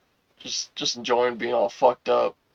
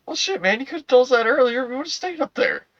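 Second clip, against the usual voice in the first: mock high-pitched reply; 11 semitones higher than usual. Retold reply to cop.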